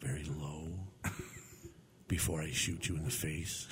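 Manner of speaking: very low, soft Steven Seagal voice; low, quiet, cold threat; very calm. Shown as a demonstration. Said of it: "I'm not gonna raise my voice".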